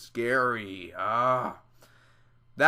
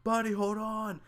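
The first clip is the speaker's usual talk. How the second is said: urgent dad call; 8 semitones higher than usual. Pretend. Imagined dad chasing the tree calls his son, higher urgent call. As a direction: call out to your son as you run. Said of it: "I'd be like".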